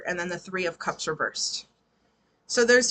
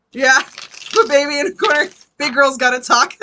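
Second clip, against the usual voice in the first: loud, higher-pitched joking through laughter; amused, laughing; 6 semitones higher, 10 dB louder than usual. Real, not acted. Reader jokes that big girl's gotta talk, laughing. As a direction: say it on the edge of cracking up.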